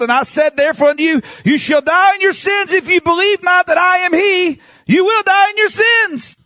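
rising to a high, insistent pulpit cry; fiery scripture preaching. Acted. Preacher warning with Jesus's words from scripture, fiery. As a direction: build the verse into a high-pitched warning.

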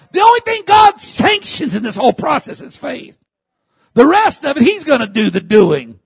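high, loud pulpit delivery; impassioned, high-pitched exhortation; fervent preaching. Real, not acted. Preaches at full force.

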